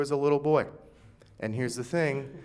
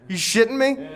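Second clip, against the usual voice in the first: incredulous; 12 semitones higher, 12 dB louder than usual. Retold or imitated. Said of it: "I was like".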